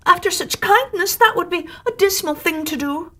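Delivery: high, wounded little voices; pleading oysters. Acted. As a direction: plead as if betrayed.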